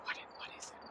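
breathy whispered question to the wind. Shown as a demonstration. Guide shows viewers how to ask the wind, whispering. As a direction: whisper it like asking the trees. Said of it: "whispering, ask".

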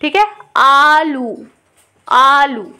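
आलू slow, with a long आ; long aa demo. Shown as a demonstration. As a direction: stretch the आ in आलू. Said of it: "ये आपको करनी है exercise". (आलू में लंबा आ)

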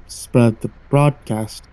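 slow, low robotic voice; a slow robot monotone. Acted. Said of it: "the creature said in a robotic voice".

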